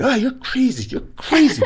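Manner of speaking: very high, excitable mimicry; hammed-up high-pitched protest; animated wingman. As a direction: imitate a buddy worked up in disbelief. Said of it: "he's very animated".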